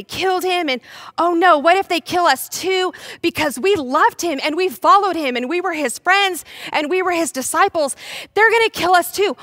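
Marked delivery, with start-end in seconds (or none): 1.2-9.4 high, loud, frantic worry; hammed-up frightened disciple; acted panic. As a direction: panic building as fears pile up. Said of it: "they're also really scared".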